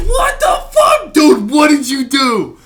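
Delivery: high, slow horrified yell; shocked shout. Retold or imitated. Friend shouting in shock at bloody roommate. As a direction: recoil at the bloody shirt. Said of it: "I'm like".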